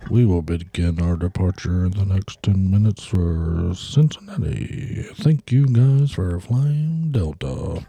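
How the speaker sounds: slow captain's cabin announcement; pilot voice. Pretend. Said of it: "Best pilot voice".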